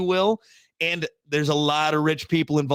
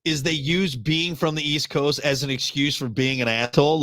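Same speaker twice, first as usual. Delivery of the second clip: ordinary talk, nothing marked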